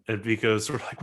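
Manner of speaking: ordinary talk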